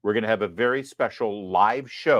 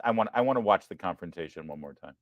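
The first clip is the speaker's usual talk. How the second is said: ordinary talk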